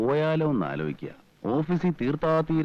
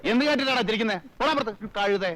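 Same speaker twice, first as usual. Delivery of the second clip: high-pitched, irritated outburst; indignant, embarrassed telling-off; angry scolding; 8 semitones higher than usual. Acted. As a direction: send the servant packing, voice pitched high. Embarrassed man throws out laughing servant.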